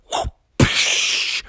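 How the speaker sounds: voiceless whoosh and smack; cartoon whoosh; tail swipe effect. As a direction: puff out a swish then a hit. Imitates tail swing.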